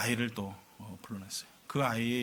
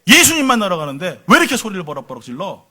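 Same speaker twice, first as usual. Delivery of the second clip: loud, sudden scolding shout; retold outburst at full volume; mother's angry yell; 3 semitones higher, 10 dB louder than usual. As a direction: act out a mother rebuking her child. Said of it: "버럭 소리 지르면서".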